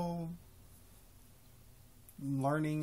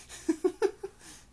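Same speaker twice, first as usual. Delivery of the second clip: high burst of laughter; 8 dB louder than usual. Real, not acted. Laughing at own story.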